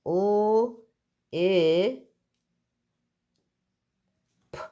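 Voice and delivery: each letter sound said separately, with pauses between. Shown as a demonstration. Teacher to pupils, sounding out a word letter by letter. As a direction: split the word into its single sounds for the class. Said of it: "If you're saying".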